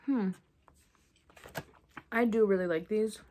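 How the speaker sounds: talking between chews; chewing. Real, not acted. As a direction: mouth partly full. Seen 1: Gummy put in mouth at -9; closed-mouth chewing through the words.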